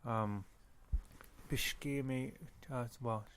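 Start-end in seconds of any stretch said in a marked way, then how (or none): none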